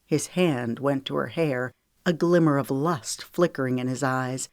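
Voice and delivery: ordinary delivery, nothing marked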